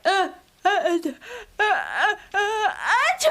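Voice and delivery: rising gasps into a sneeze; sneeze build-up. Acted. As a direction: catch your breath, then sneeze. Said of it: "Milky Ann sneezes like that".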